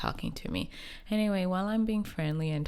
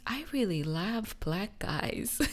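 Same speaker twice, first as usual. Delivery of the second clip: imitating a stranger; 6 semitones higher, slower than usual. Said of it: "And she says".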